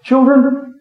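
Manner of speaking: put-on high, lifted call to children; appealing call. Preacher quotes the apostle addressing his readers, appealing call.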